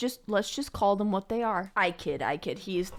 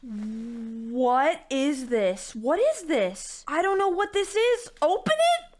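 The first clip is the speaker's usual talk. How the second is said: slow at first, climbing high in disbelief; astonished; 5 semitones higher, slower than usual. Real, not acted. Opening a mystery birthday gift, astonished. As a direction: draw out the question, then pitch rises sharply.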